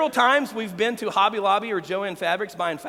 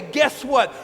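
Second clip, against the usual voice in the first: climbing louder and higher, each refrain harder; rising altar call; 3 semitones higher, 3 dB louder than usual. Acted. Pastor building an altar call to his congregation, rising. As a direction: build the appeal to a peak.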